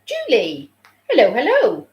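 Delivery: bright singsong hello; cheerful greeting. Real, not acted. Greeting a viewer in chat.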